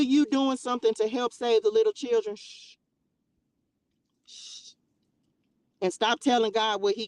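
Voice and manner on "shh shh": soft shushing; quiet shh to the haters; hushing. Real, not acted. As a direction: hush the critics. Hushing critics.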